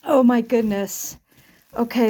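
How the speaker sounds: usual speaking voice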